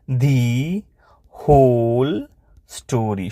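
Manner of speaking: word by word with long pauses; slow dictation. Shown as a demonstration. Teacher dictating the woodcutter's answer, slow dictation. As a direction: stretch and separate each word for writing.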